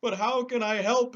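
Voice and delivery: a big cowardly lion protesting; lion, high and loud. Acted. Lion voice continues.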